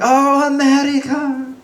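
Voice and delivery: high, drawn-out imitation of delighted awe; excited Polish lady. Polish neighbor on meeting an American, hammed-up excitement. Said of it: "and she was excited".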